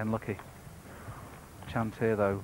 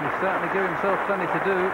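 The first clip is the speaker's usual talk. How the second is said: excited; 7 semitones higher, 8 dB louder than usual. Real, not acted. Excited reaction to the shot.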